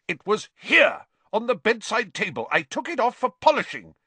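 high, indignant shouting. A narrator's reading. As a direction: insist loudly that it was right there. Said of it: "Percy was shouting".

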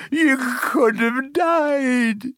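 very high, drawn-out tearful wail; sobbing. A narrator's reading. Hagrid to Harry after nearly losing him, sobbing. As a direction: sob each word out slowly. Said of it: "sobbed Hagrid".

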